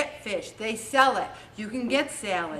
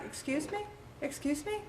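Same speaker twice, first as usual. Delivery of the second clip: softer, rising, pointed; pointed excuse me; 4 semitones higher, 12 dB quieter than usual. Retold or imitated. Leader challenging a member's self-talk, pointed and soft. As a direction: raise it as a warning, twice. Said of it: "I'm like".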